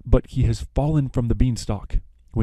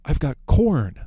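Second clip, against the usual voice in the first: higher, a lightly voiced eager interruption; sudden idea; 6 semitones higher than usual. The baker stops everyone with his corn, mock eager. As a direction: blurt it like a sudden brainstorm. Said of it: "the baker decides".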